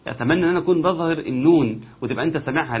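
ordinary talk

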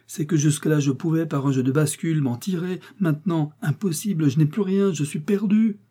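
a man at the end of his rope; husband admits to his wife he is ruined, rising despair; voiced husband, despairing.

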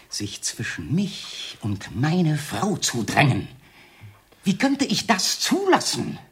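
slow, deliberate, climbing to a high cry; drawn-out words breaking into high fury; mounting jealous rage. Acted. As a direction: build from controlled menace to outburst. Jealous outburst building.

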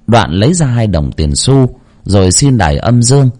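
plain reading voice

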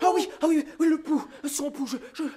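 high loud flustered stammering. Acted. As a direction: babble in a panic.

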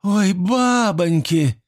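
high, drawn-out village woman's cry; woman's exclamation. A narrator's reading. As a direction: exclaim it high and slow to the other women. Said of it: "воскликнул всё тот же голос".

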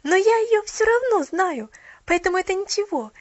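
high, loud breathless chatter; rapid chatter. A narrator's reading. Girl explaining to Mrs Carew, breathless. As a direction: chatter on without a pause. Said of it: "затараторила она".

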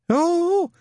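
very high startled squeak. Acted. Newton startled by Shadwell, a high squeak. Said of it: "squeaked Newton".

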